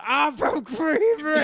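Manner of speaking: high, drawn-out garbled yell; mock a dim-witted fan hollering; slow, high-pitched slurred shout; mimicked slurred heckler. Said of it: "make believe he's mentally challenged".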